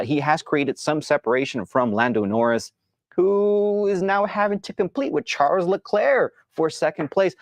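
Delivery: normal talking voice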